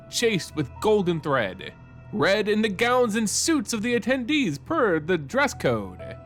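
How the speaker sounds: ordinary delivery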